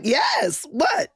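mock startled. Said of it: "I'm like".

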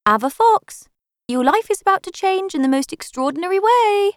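high, loud, shrill phone voice; a ringing phone proclaiming fate; shrill announcement. A narrator's reading. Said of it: "it had announced shrilly".

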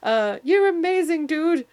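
high, loud starstruck gush; husband awestruck by the fit friend, hammed-up adoring gush. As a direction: gush up at him like a fan. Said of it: "Neil's looking up to him going".

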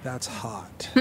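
very soft, low catchphrase; Paris Hilton. Acted. Host imagines the heiress's useless reaction, hushed impression. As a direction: drop to a hushed celebrity impression. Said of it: "You decide she'd say".

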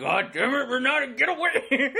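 hammed-up fed-up parent; imitating annoyed parents. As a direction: shoo the kid away in exasperation. Voicing parents shooing daughter away.

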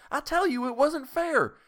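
high, loud pleading; desperate protest. A narrator's reading. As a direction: plead loudly, fading at the end. Tessie protesting, pitch high.

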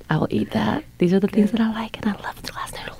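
breathy excited whisper. Retold or imitated. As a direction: whisper it like a secret craving. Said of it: "I'm like".

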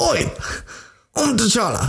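lightly imitated Wakandan accent; a hint of the Wakandan accent, played for laughs; mock Wakandan accent. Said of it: "I was trying to do the real accent".

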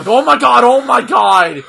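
loud, stunned oh my god; shocked exclaiming. Real, not acted. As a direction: blurt it out in shock. Reacting to a brutal spot.

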